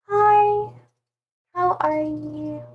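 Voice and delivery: high, shy greeting; Bixby. Acted. Streamer guessing the Bixby vibe, high and shy. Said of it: "She seems like a really shy person".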